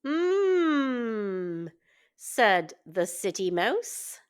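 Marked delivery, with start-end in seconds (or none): 0.0-1.7 high, savoring hum of delight. Acted. City mouse eyeing the feast, a high delighted mm. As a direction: relish the food you see.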